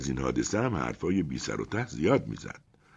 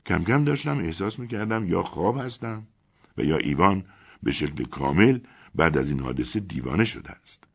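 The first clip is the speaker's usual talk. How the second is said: usual speaking voice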